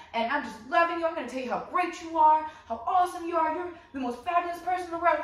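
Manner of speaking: shower a new partner with giddy promises; love bombing. Acted.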